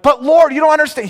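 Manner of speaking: high, loud pleading whine; whiny protest. Retold or imitated. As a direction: whine like a kid caught out. Arguing back with God.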